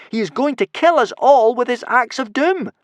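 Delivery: high, loud alarm; yelling. A narrator's reading. Hiccup warning of the axe of doom, high yell. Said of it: "yelled Hiccup".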